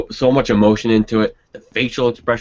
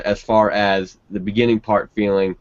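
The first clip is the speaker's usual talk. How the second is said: ordinary talk, nothing marked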